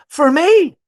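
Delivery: high, loud, mock-surprised squeak; touched surprise. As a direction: imitate someone astonished at getting a gift. Server's surprised reply to a gift.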